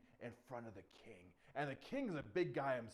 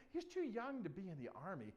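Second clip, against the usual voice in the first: ordinary delivery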